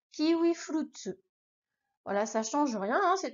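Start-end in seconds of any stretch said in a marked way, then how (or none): 0.1-1.2 kiwi flutsu, Japanese katakana style; Japanese kiwi furutsu. Shown as a demonstration. Teacher showing the full katakana form. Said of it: "on a flutsu derrière".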